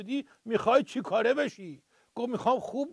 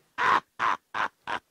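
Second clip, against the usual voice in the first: breathy laugh; 13 semitones lower than usual. Acted. Host laughs at punchline.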